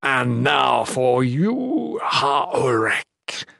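slow, stretched-out monster taunt; menacing monster villain. A narrator's reading. Monster turning on his last foe, slow menace. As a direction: savour the threat slowly.

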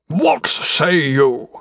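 slow, drawn-out challenge; stretched-out, emphatic demand; sharp final demand. A narrator's reading. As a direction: fling it out as a final accusation. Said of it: "shot at the Queen like a murderous strike of lightning".

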